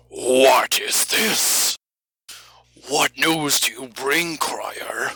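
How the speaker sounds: lightly hammed-up, drawn-out query; in-character questioner. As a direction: receive a herald with mock grandeur. Acting out the scene with crier.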